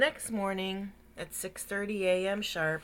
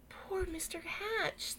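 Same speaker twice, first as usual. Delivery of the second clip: slow, high, softer sympathy; pitying; 11 semitones higher, 4 dB quieter, slower than usual. Acted. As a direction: sigh it out with pity. Neighbours pitying Mister Hatch.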